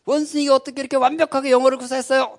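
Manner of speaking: high-pitched mock amazement. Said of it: "사람들이 깜짝 놀랐대요". (높은 목소리로 놀란 척)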